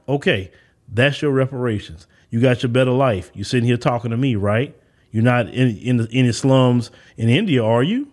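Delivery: low-pitched, pointed comeback; blunt rebuttal. Acted. Speaker schooling an imagined opponent on reparations, bluntly, in a low voice. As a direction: drop low and press the point with tag questions. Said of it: "you gotta talk like that to people".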